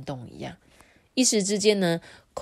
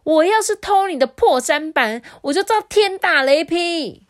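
much higher and much louder sworn oath; angry yell; 12 semitones higher, 9 dB louder than usual. Acted. Brother swearing to his brother he never stole, shouting. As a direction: swear it at the top of your lungs. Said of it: "也吼着说".

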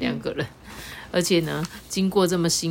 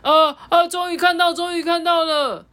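high, loud excited cry; overjoyed at finally seeing it; excited shout; 12 semitones higher, 6 dB louder than usual. Acted. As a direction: shout it out in joy. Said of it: "韩吉兴奋的大叫".

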